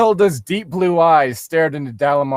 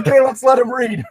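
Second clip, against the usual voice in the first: loud, high call to stop; shouting; 7 semitones higher, 4 dB louder than usual. Real, not acted. Player shouts at the others to let him read. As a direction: shout them down.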